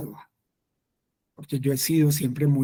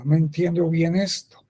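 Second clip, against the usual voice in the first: ordinary delivery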